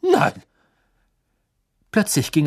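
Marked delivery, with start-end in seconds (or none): 0.0-0.4 very high, loud denial; shocked cry. Acted. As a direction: cry out in disbelief. Crouch denies in shock.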